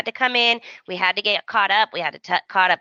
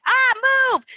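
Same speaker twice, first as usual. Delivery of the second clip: high squeal of move; mock panicked yelp, high and sharp; high mock yelp to a man in her way; startled yelp. Retold shout at cart guy.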